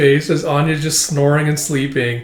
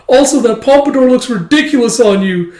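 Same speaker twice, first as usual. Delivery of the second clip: mock girlish scorn; 8 semitones higher, 5 dB louder than usual. Mocking girl voiced in recap.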